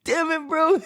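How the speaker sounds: high, squeaky words through laughter; helpless high-pitched laughing exasperation. Retold or imitated. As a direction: crack up while protesting. Cracking up at the kid's answer.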